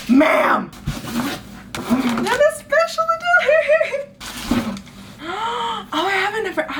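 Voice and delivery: overwhelmed by yet another gift; laughing excited shout. Real, not acted. Overwhelmed by a big package.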